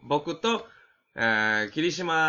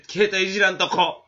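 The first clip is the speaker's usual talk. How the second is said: emphatic; 6 semitones higher, 7 dB louder than usual. Acted. Repeats resolution emphatically.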